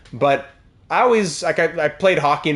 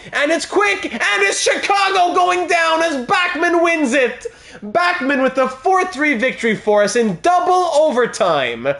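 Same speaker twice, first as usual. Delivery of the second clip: much higher, ecstatic goal call; 11 semitones higher than usual. Real, not acted. Streamer calling his team's double overtime winner, ecstatic and high. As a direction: shout the winner like a commentator.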